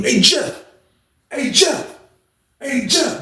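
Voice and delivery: high, calling up the stairs; imitating a high call to a neighbor. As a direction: call his name up the steps. Calling up to Jeff in cell.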